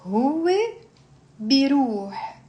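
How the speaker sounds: pronoun, long pause, then verb; slow conjugation drill. Shown as a demonstration. Teacher leading students through a verb, slowly. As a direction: space the two words widely apart. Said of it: "you slowly build up".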